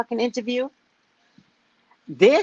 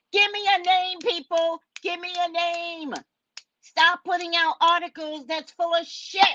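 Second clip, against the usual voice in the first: mock official demand; 9 semitones higher than usual. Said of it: "Mike Jackson ... said".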